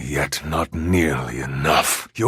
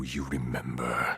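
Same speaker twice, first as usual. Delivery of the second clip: whispered taunt; 5 semitones lower, 11 dB quieter than usual. Acted. The spirit Geist taunting Oma, whispered. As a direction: hiss the reproach in a whisper.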